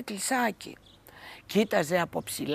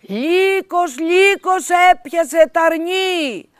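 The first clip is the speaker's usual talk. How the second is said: much higher, much louder, slow drawn-out cries; shouting for help; 9 semitones higher, 15 dB louder, much slower than usual. A narrator's reading. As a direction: yell across the valley to the villagers. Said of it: "αρχίζει να φωνάζει".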